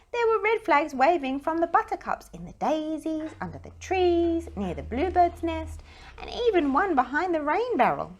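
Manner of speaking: high, loud list piling up; rising excitement. A narrator's reading. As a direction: build the surprise at every flag. Flags everywhere, building.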